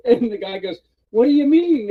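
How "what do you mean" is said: high, loud disbelief; mock-baffled outburst; incredulous boss. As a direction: imitate a baffled boss demanding answers. Boss reacts to red map.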